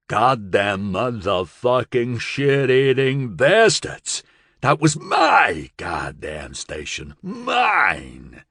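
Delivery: drawn-out, enraged gangster outburst; furious gangster. A narrator's reading. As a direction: rage like a gangster robbed of his turf.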